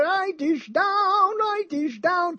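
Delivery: high, slow sing-song "Write this down"; Doctor Fabliano catchphrase. Retold or imitated. Host mimics Doctor Fabliano's catchphrase, high and slow. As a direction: drag it out like a radio doctor's jingle. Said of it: "as Doctor Fabliano goes there".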